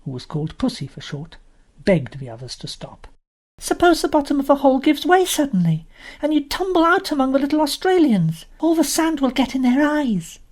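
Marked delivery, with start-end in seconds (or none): 3.6-10.4 put-on high, anxious child voice; a small girl fretting over the hole; worried little girl. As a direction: Jane frets, a timid little sister pleading with her brothers.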